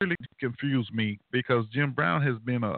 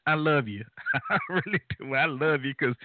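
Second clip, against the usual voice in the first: laughing affectionately between phrases; 3 semitones higher than usual. Real, not acted. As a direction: say it through bursts of real laughter. Amused response to Pat's remarks.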